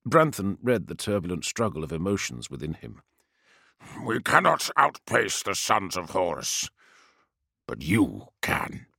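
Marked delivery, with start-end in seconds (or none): 4.0-8.9 solemn. Acted. Captain sacrifices himself.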